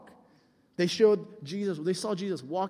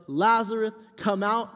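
mild mock yell, raised pitch; imitated commanding call; retold yell; 3 semitones higher, 5 dB louder than usual. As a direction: call out to summon him, half-full force. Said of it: "And he yells".

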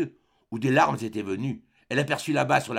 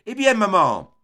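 loud, higher call across the beach; 8 semitones higher, 8 dB louder than usual. A narrator's reading. Jean calls out to his approaching mother. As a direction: call out to her from afar. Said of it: "Jean lui cria".

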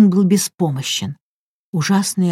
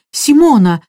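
louder, higher frantic cry; shouting; 6 semitones higher, 6 dB louder than usual. A narrator's reading. Raoul freed, running to the medium, alarmed shout. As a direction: shout her name in dread. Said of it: "закричал Рауль".

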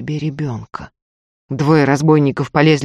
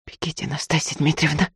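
urgent hushed warning, much softer; whispered; 7 dB quieter than usual. A narrator's reading. Maid secretly urging her lady to flee, whispered. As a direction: barely voice it, just with the lips. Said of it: "одними губами прошептала Дунька".

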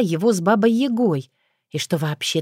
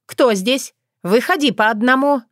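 loud, higher challenge through the door; suspicious guard; 6 semitones higher, 3 dB louder than usual. Acted. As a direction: bark out orders to intruders. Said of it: "зычный голос Гориславы".

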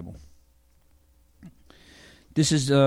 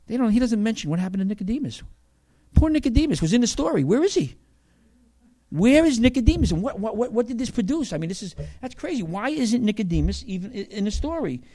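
puzzled; 8 semitones higher than usual. Real, not acted. Mock puzzlement over Nicodemus.

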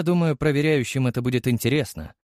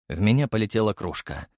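usual speaking voice

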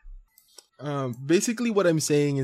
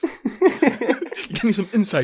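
laughing; 11 semitones higher, 5 dB louder, slower than usual. Real, not acted. Teasing friend through laughter.

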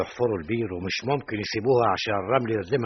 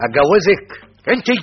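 loud outraged question; shocked disbelief; 8 semitones higher, 11 dB louder than usual. Acted. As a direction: recoil at the very idea. Shocked at proposal. (سؤال مصدوم بصوت عالٍ)

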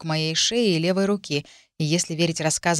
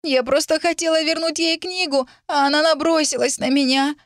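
put-on very high, whining child's voice; sobbing complaint; 10 semitones higher, 3 dB louder than usual. A narrator's reading. Girl accuses heroine to the adults, sobbing. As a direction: wail it out like an injured child. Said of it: "рыдала девочка".

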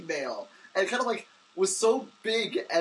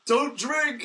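retold jeer; 7 semitones higher, 4 dB louder than usual. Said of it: "shouts something really mean".

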